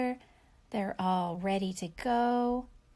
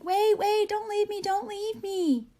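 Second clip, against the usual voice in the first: high, loud panicked calling after the car; scared pleading; 10 semitones higher, 6 dB louder than usual. Retold or imitated. Teacher acting her younger self begging parents to wait, high and loud. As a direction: cry out after a leaving car, frightened. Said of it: "So I was a little bit scared".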